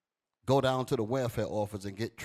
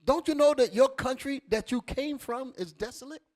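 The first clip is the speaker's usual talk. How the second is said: louder, higher proclamation; preaching the Lord's words; 11 semitones higher, 5 dB louder than usual. Acted. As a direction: speak as the Lord warning. Voicing the Lord, raised.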